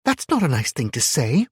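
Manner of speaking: put-on high, loud, prim child's scolding; scolding little girl. A narrator's reading. Little girl scolds the man for his rude remark. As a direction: tut at him like a strict schoolgirl. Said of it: "she scolded me".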